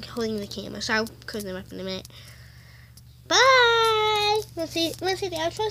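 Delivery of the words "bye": put-on little girl doll voice. Doll says goodbye.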